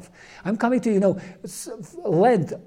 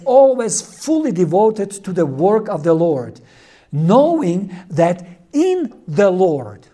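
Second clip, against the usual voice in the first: ringing scripture reading from the pulpit; emphatic scripture reading; 5 dB louder than usual. A narrator's reading. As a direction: read it out firmly, stressing each phrase.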